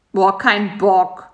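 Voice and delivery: slow, unwilling groan; lazy refusal. A narrator's reading. Spider refusing to go out, slow and lazy. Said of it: "sagte Karl-Heinz".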